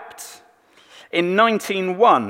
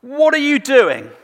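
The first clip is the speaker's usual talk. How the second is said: much higher and louder, an outraged yell; angry shout; 11 semitones higher, 7 dB louder than usual. Retold or imitated. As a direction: burst in yelling at the king. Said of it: "he says to David".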